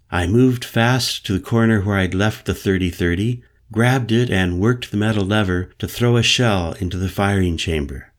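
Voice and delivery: ordinary delivery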